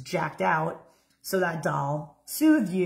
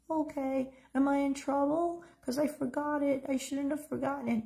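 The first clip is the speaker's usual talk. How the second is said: put-on high, soft, whispery five-year-old; small frightened child near a whisper; a five-year-old afraid she left her doll; scared little girl; 8 semitones higher, 5 dB quieter than usual. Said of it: "that little five-year-old girl".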